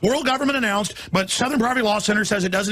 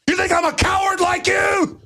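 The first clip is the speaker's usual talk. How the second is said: angry shout; 8 semitones higher, 3 dB louder than usual. Real, not acted. Shouted challenge.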